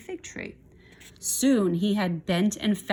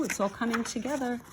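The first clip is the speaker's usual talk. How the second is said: usual speaking voice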